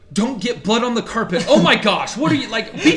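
imitating mom fussing over the carpet; mom's complaint. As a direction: nag about the carpet. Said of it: "she goes".

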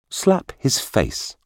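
slow, high, firm command. A narrator's reading. Minister orders the bodyguard, firm. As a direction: give a measured, firm order. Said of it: "said the minister in a firm voice".

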